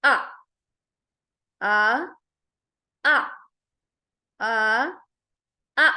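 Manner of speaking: آ cut off with a hard glottal stop; clipped stopped آ; hamza with a jolt. Shown as a demonstration. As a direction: jolt each آ short and sharp. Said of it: "سکون کے ساتھ ہے تو".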